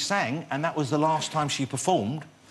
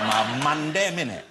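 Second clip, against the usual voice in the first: playful put-on London street slang; a clumsy try at London street talk; mock London street accent. Host tries a London street accent to sound cool, mock London street accent. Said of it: "I don't know what that accent is".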